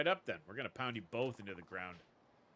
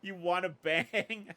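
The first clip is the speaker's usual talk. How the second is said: loud, slow, higher mocking voice through laughter; laughing mock come-on; 9 semitones higher, 9 dB louder, much slower than usual. Woman taunting the thug, laughing and loud. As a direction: tease him by aping his line.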